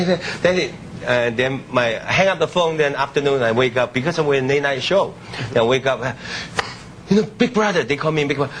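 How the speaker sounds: ordinary delivery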